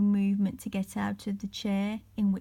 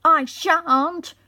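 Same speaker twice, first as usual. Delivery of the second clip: Peter shouts back, much louder; defiant cry; 8 semitones higher, 11 dB louder than usual. A narrator's reading. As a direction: refuse loudly and defiantly. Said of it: "cried Peter".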